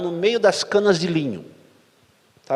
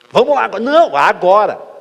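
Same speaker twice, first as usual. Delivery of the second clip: louder, firm insistence; wife insisting on going right now, raised and firm; insistent wife; 6 semitones higher, 9 dB louder than usual. Retold or imitated. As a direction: push him out the door with no argument.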